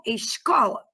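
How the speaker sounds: "escola" as esh-KO-la, sh before the k; hushing Carioca S. Shown as a demonstration. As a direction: show how Rio says "escola", with sh. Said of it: "Vocês falam escola".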